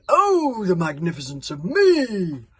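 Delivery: loud, slow, self-adoring bellow; boastful yell. A narrator's reading. Chieftain yelling his own praises to the crowd. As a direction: yell it like a preening giant. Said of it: "yelled the mighty chieftain".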